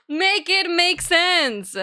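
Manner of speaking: high, drawn-out complaining; exasperated plea. Real, not acted. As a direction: beg the universe to make sense. Venting about weak PC.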